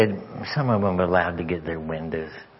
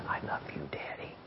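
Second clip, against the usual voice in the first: barely audible, loving whisper; tender whisper; 5 semitones lower, 15 dB quieter than usual. Retold or imitated. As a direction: whisper it as a child held in a hug. Retold hug, daughter's loving words.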